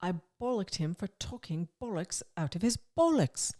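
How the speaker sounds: slightly high, hammed-up English accent. Shown as a demonstration. Co-host showing off the swear book word, rusty English accent. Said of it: "My English accent is not".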